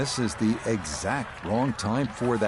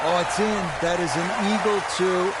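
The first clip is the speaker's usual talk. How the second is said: excited; 6 semitones higher than usual. Real, not acted. Eagle holed, commentator reacts.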